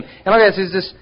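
normal talking voice

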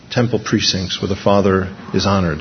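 ordinary talk

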